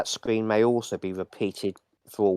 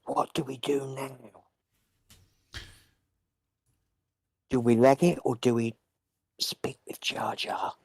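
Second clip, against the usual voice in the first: very low whisper; 5 semitones higher, 4 dB quieter than usual. Acted. Player asking the party whether to leg it, barely audible whisper. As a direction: whisper it so only your companions hear. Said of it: "I'll whisper very low, it's, uh, almost just mouthing it".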